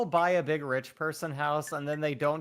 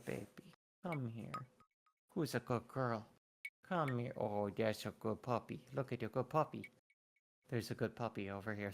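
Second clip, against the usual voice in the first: cooing to a scared pet; 7 dB quieter than usual. Real, not acted. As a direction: coax the dog over softly. Cooing to dog.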